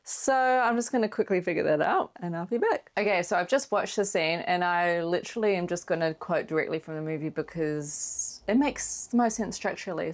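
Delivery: ordinary talk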